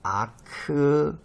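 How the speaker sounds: slow "a-kri" with a long 리; Greek pronunciation. Shown as a demonstration. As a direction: stretch 아크리 syllable by syllable. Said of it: "그리스어도 여기에".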